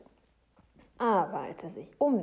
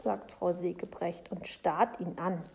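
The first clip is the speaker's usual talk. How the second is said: ordinary delivery, nothing marked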